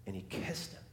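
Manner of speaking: a hushed, reverent whisper; whispered tenderly. Acted. Preacher telling the father's kiss, in a whisper.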